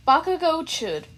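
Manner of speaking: usual speaking voice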